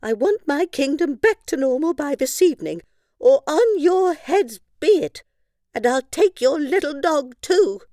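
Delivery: high loud royal threat; a cross queen threatening them; moody queen. Acted. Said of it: "cried the moody queen".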